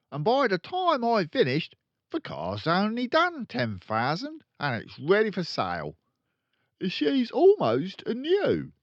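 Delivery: loud, high, brash dealer father; boastful crooked dad. Acted. Father bragging to his kids, loud and brash. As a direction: brag like a swaggering car salesman.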